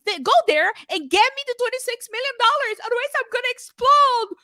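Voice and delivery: high, shrill, hammed-up boss; mock CEO voice. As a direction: squeal it like a greedy boss. Mocking the CEO.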